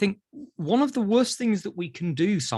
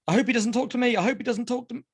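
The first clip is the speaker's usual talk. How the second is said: put-on anxious child's thought; 6 semitones higher, much faster than usual. Voicing anxious child avoiding therapist.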